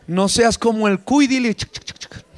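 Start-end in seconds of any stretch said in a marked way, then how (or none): 1.5-2.2 voiceless, soft clicking squeaks; guinea pig chirping. Acted. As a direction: imitate a guinea pig's chatter. Imitates a guinea pig's noise.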